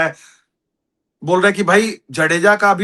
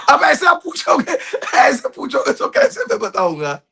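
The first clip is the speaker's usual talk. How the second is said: higher, laughing protest; 11 semitones higher than usual. Real, not acted. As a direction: laugh while dodging the question. Laughing after being caught. (हँसते हुए ऊँची आवाज़ में बहाना)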